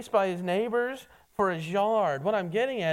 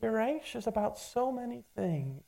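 softer, calm and caring, slowing her down; 3 semitones higher, 4 dB quieter than usual. Retold or imitated. Jesus calmly stopping worried Martha, soft and caring. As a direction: gently settle a panicked friend. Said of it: "he just stops and he calmly says".

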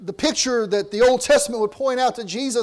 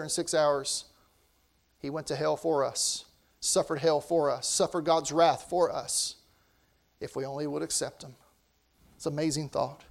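hushed sermon; 6 semitones lower, 9 dB quieter than usual. Real, not acted.

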